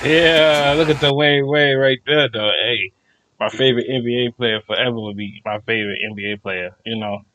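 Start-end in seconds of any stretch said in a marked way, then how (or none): none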